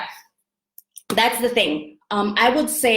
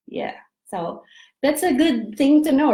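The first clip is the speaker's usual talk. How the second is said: usual speaking voice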